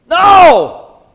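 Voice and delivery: a sudden high, loud cry; shouted no. Acted. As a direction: bark out the denial. Preacher's sudden emphatic denial.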